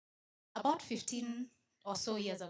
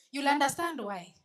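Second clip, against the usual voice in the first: higher and louder promise; teasing promise; 5 semitones higher, 10 dB louder than usual. Shown as a demonstration. Promising the audience they will understand, louder. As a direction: tease the crowd, hint at a twist.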